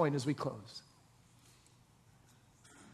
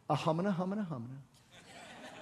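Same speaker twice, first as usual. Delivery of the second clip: mock mumbling; 5 dB quieter than usual. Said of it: "That's in your Bible".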